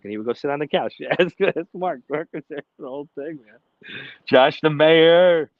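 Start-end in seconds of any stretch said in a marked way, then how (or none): 4.3-5.5 very slow, loud, drawn-out announcement; announcer call. Acted. Host announcing a super chat donor, slow and booming. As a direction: boom the name like a ring announcer.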